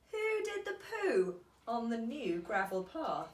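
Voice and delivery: ordinary delivery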